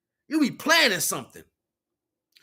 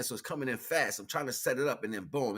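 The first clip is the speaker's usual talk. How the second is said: usual speaking voice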